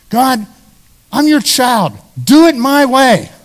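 loud, high insistent plea; a child demanding God do it his way; pleading demand. Acted. Believer insisting to God in prayer, loud and high.

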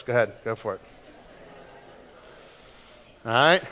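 normal talking voice

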